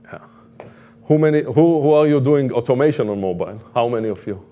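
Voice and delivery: normal talking voice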